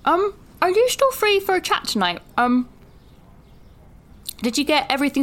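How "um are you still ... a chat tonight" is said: ordinary talk, nothing marked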